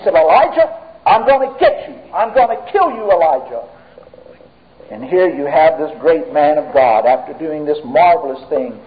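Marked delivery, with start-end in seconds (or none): 0.1-3.6 high-pitched acted threat, dropping on the final name; mock angry threat. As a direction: play a touch of the angry queen's threat. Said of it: "and she was angry".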